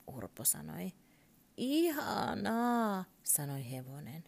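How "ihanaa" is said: horse voice. Acted. Horse speaks its only word.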